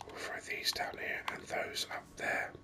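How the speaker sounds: ordinary delivery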